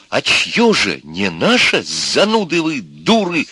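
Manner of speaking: slow, swooping indignant scolding; angry retort. A narrator's reading. Brother shouting at his sister. (возмущённая брань брата)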